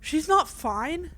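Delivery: loud, slow, desperate protest; a panicked son insisting she is not fine; frantic shout. Acted. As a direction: shout it out in disbelief. Game grandma collapse, panicked protest.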